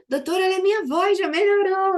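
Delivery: high, loud, rushed imitation of delight; childlike excitement. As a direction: exclaim like a child with a new toy. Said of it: "é como criança quando descobre um".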